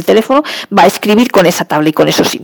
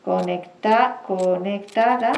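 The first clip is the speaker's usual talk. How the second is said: dictating; 7 dB quieter, much slower than usual. Shown as a demonstration. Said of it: "Vamos a escribir".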